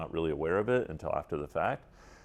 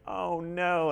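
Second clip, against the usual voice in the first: breaking down; 12 semitones higher than usual. Retold or imitated. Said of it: "started bawling".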